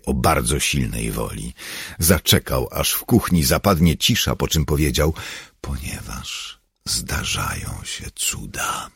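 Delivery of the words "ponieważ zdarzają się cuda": low, slow, hushed voice; quiet, slow, mystical assurance; solemn pronouncement. A narrator's reading. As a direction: let it fall softly into the silence. Leader answers after waiting for silence.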